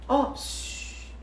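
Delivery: soft, drawn-out shush; hushing shh. Pretend. Preacher silencing the voices in the listener's head, soft hushing. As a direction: hush the noisy voices with a long shh.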